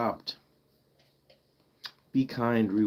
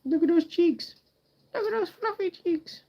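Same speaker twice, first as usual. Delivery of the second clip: high-pitched cooing. Real, not acted. Streamer fawning over fluffy cheeks, high squeaky baby talk. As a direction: coo way up high at a cute pet.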